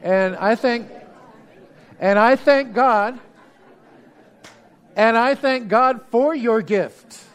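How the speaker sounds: rising, swelling thanks to God; preaching build. Acted.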